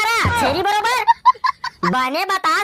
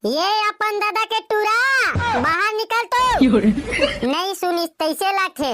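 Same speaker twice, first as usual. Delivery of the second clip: calling out; 3 semitones higher than usual. Acted. Calling the boy out of house.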